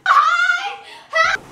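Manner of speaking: high laughing pretend call for help; mock cry for help. Pretend crash victim calling out, laughing. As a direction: yell for help half joking.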